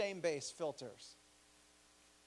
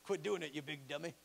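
Red Fox impression; 3 semitones lower, 4 dB quieter than usual. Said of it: "he might sound like Red Fox to you".

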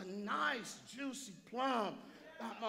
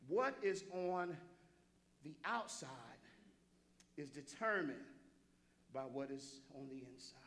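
measured emphatic delivery; 8 semitones lower, 6 dB quieter than usual. Real, not acted. Preacher landing his key point for the congregation, measured and hushed. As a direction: space it out, sinking quieter on the last phrase. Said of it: "let me say it again".

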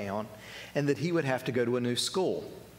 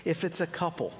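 ordinary talk, nothing marked

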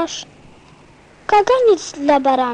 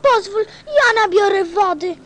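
higher and eager, full of joy; eager boy thrilled by the singing well; delighted; 5 semitones higher than usual. Acted. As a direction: gush with childlike delight.

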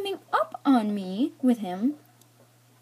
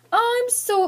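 overdone concern; 13 semitones higher than usual. Retold or imitated. Girl retelling her apology to the boy, overdone concern. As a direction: gush with concern in a raised pitch. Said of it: "being really concerned".

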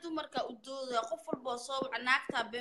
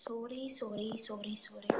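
whispering; 10 dB quieter than usual. Real, not acted. Host apologizes off-mic.

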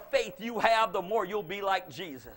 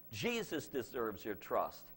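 much lower, softer and slow; solemn, sincere; 11 semitones lower, 8 dB quieter than usual. Acted. Preacher telling the church where trust belongs, hushed. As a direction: lay it down gently and earnestly. Solemn turn after the list.

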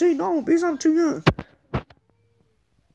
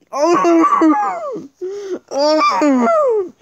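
1 loud longing groan; 4 dB louder than usual. Pretend. Tempted by pizza.

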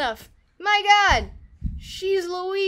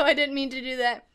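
ordinary talk

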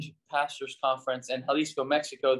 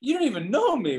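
high, louder, stung mock protest; affronted protest; 11 semitones higher, 3 dB louder than usual. Pastor retelling bristling at Randall's rebuke, high stung mock protest. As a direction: bristle at being judged. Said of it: "I'm listening to him like".

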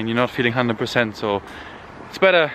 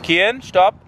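calling out; 9 semitones higher, 5 dB louder than usual. Real, not acted. Toddler won't stop.

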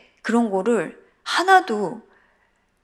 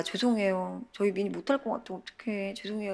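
much softer, sheepish apology; apologetic murmur; 9 dB quieter than usual. Retold or imitated. Mother apologizing to camera crew, soft and sheepish. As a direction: mumble sorry to the crew. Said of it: "이러면서".

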